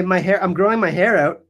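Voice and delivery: normal talking voice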